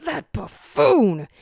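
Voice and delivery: loud, slow, contemptuous; scornful outburst. Acted. Zero sees Barker in the mask, loud and scornful. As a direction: spit out the insult.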